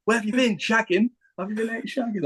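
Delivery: high mock yell at a mate; teasing yell from the doorway; shouting. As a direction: shout it up high across the room. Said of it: "[name] starts shouting".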